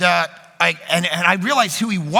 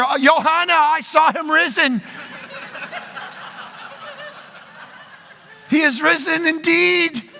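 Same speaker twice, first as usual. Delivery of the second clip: higher, slow, hammed-up first-person Easter voice; mock Norm preaching Easter; 6 semitones higher than usual. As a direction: parody a stiff pastor playing a Bible witness, laughing through it. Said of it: "Norm Funk trying to do that".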